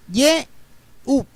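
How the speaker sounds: "Yehú" with an initial Y sound, split ye... u; pronunciación hebrea. Shown as a demonstration. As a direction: say "Yehú" slowly in two parts, starting with Y. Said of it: "Con Y empieza". ("Yehú" con sonido de Y inicial, separado en ye... u)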